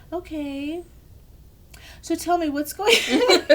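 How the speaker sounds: high, slow, drawn-out reply breaking into laughter; mock delicate questioning, ending amused; careful doctor imitation. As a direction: voice a taken-aback doctor treading carefully. Doctor responding to her loud sobbing.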